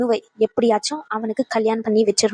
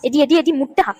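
a sharp, higher, scolding call to her daughter; scolding shout; 4 semitones higher than usual. Acted. Mother scolding her foolish daughter, sharp and higher.

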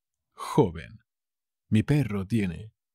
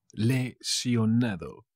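"Lesionado" drawn out in separate pieces; slow, syllable by syllable; slower than usual. Shown as a demonstration. Teacher repeating a word for learners, slow and broken up. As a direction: break "Lesionado" up slowly.

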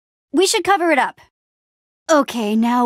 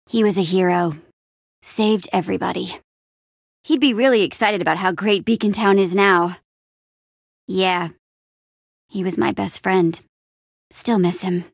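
low, subdued fondness; understated, low-pitched sadness; wistful remembrance; 4 semitones lower than usual. Acted. Remembers lost best friend.

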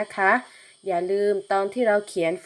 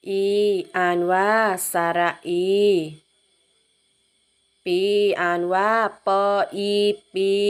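spelling drill; 5 dB louder than usual. Shown as a demonstration. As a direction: sound out each syllable evenly for children to follow. Leads a slow spelling drill. (อ่านสะกดคำช้าๆ ลากเสียงทีละพยางค์)

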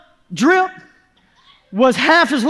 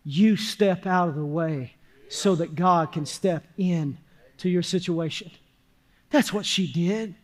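much lower and softer, with long pauses; hushed preaching; 7 semitones lower, 8 dB quieter than usual. Real, not acted. Preacher tells church how she took her burden to God, hushed. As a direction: bring it right down, quiet and deliberate.

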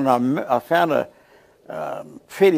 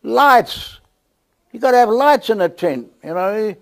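higher, louder matter-of-fact voice; Don explaining; 8 semitones higher, 5 dB louder than usual. Retold or imitated. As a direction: lightly voice old Don, pleased with himself. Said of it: "I said, "And what's that for?".

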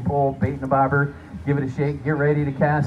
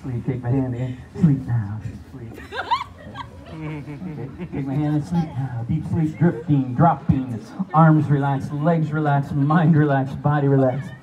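hypnotic induction; 5 dB louder than usual. Acted.

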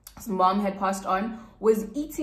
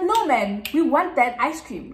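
eager pleading; 4 semitones higher, 5 dB louder than usual. Retold or imitated. Friends begging to stop for ice cream.

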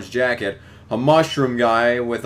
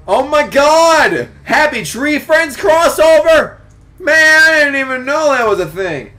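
excited outburst; 9 dB louder than usual. Real, not acted. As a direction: shout in amazed excitement. Reader reacts to surprise crossover.

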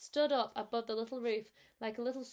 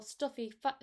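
usual speaking voice

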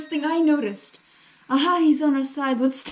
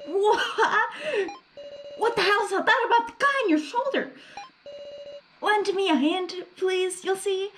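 high character voice; 7 semitones higher than usual. Acted. Voicing a surprised character.

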